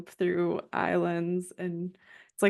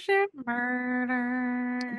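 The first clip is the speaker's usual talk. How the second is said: slow ominous echo; 5 semitones higher, much slower than usual. Acted. Echo, drawn out dramatically.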